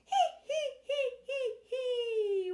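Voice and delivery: loud, squealed "hee hee" giggle; staged giggle at the top of the voice; very high laugh. Shown as a demonstration. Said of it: "nice and high".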